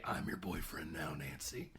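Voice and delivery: low, menacing monster voice; Freddy's voice. Retold or imitated. Freddy taunting Nancy on the phone, low and menacing. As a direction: creep it out low and quiet like a killer. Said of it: "you hear Freddy's voice say".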